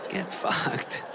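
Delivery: whispered curse; muttered dismissal. Retold or imitated. Comedian whispering a curse at the dog. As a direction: hiss it at the dog under your breath. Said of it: "I go".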